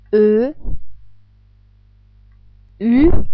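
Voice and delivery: A, B, C as "ah, beh, tseh", each rising; German letter names. Shown as a demonstration. German native speaker showing learners the letters, German names from A to Ü. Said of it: "how to pronounce the German letters".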